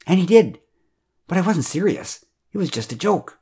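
high-pitched, dismayed excuse; defensive protest. A narrator's reading. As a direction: protest your innocence, voice lifted. Chuck protests his guilt in story.

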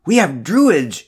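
declaring. A narrator's reading. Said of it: "she declared".

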